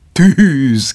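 loud, high happy cry; joyful shout. A narrator's reading. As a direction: shout it with delight. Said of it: "kiáltotta boldogan Prométeusz". (boldog, hangos kiáltás)

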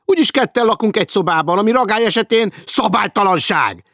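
high, loud outraged shout; shouted threat. Acted. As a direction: yell the threat at him. Hecker keeps shouting complaint.

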